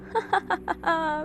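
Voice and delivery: eerie short laugh; strange laugh. A narrator's reading. The masked rescuer laughs over the doctor's body, eerie laugh. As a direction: chuckle like a smug killer. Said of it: "अजीब से smile के साथ हंसता है".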